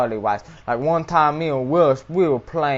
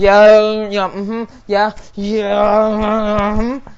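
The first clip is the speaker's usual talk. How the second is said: dreamy mm-hmm; 5 semitones higher, much slower than usual. Acted. Kat's dreamy reply to poem.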